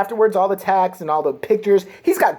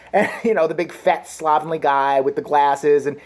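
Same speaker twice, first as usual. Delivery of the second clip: ordinary talk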